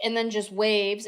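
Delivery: ordinary talk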